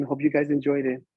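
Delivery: ordinary delivery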